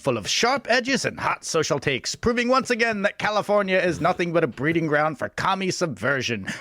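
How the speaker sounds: high-pitched, hammed-up alarmist announcer; over-the-top moral-panic warning; silly impression voice. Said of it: "that's the end of the impression era".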